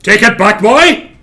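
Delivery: high, very loud motherly command; stern shout. Retold or imitated. Strict mother ordering her son, in a stern shout. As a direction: bellow it at a child.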